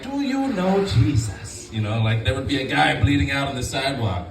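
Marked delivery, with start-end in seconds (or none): 0.0-1.7 grandma asking. Retold or imitated. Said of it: "be like".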